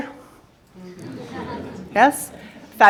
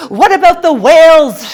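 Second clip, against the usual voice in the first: high, loud mock-outraged cry; outraged scream; 7 semitones higher, 10 dB louder than usual. Cousin confronting boomer bosses over plastic, hammed-up high scream. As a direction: scream it like an appalled young activist. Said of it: "she'll scream at you".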